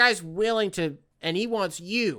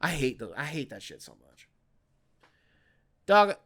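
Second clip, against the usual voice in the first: ordinary talk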